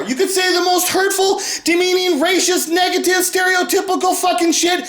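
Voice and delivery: high, forceful yelling; ranting shout. Real, not acted. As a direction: hammer each insult out. Ranting at critics.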